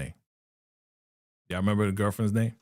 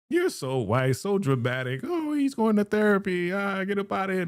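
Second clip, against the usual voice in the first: dismissive Naomi; 8 semitones higher than usual. Retold or imitated. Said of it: "'cause she's like".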